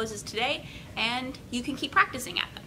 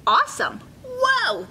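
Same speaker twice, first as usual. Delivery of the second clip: loud, high wow of amazement; excited crowd; 14 semitones higher, 6 dB louder, slower than usual. A narrator's reading. Beach crowd marvelling at Gideon's castle, excited. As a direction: gasp it like a thrilled beachgoer.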